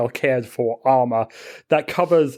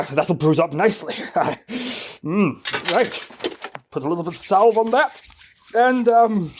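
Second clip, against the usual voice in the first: higher-pitched, self-scolding character voice; self-punishing zealot; 6 semitones higher than usual. Acted. Zealot scolding himself after hammering his own back, self-punishing.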